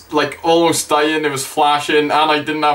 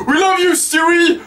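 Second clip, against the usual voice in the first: high fan holler; re-enacted shout; 12 semitones higher than usual. Fan heckling Seth as Stewie, high shout. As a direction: call out adoringly, pitched way up. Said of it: "and I shouted".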